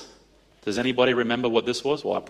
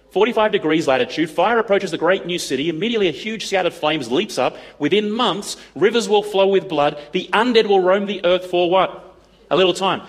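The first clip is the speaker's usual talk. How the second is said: dramatic prophecy reading; 4 semitones higher than usual. Acted. Reads prophecy aloud dramatically.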